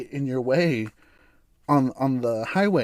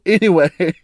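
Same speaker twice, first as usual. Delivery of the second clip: anyway said through a laugh; louder, laughing anyway; 3 semitones higher, 8 dB louder than usual. Real, not acted. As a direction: laugh it off and move on. Laughing after own joke.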